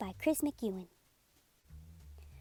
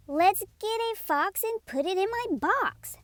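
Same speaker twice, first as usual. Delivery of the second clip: higher, quick and eager; eager dog voice; 8 semitones higher than usual. Acted. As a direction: play the puppy full of energy. Dog speaks.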